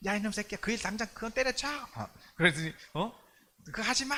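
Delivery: imitating an angry mother yelling at her son; a mother's mock angry scolding. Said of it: "엄마 막 그 화내는 소리가 들려".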